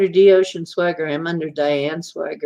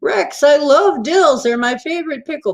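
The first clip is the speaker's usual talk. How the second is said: mock innocent; 9 semitones higher, 3 dB louder than usual. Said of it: "I'm like".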